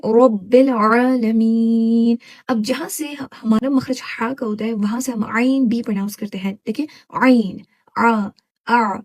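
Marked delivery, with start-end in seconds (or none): 0.1-2.2 very slow रब्बिल आलमीन with sharp ain. Shown as a demonstration. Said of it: "क्या पढ़ेंगे?". 8.0-8.9 sharp throaty ain held; isolated sharp throat ain; sharp ain sound. Shown as a demonstration. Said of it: "ऐसी sharp आपने voice निकालना है".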